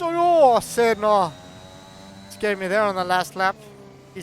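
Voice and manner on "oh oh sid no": startled commentator cry; alarmed outburst. Real, not acted. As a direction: jolt up in pitch and volume as the car slips. Crash on last lap, commentator alarmed.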